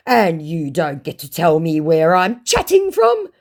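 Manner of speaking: high, loud indignant scammer voice; mock scammer. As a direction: read it as an affronted scammer. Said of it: "said my scammer".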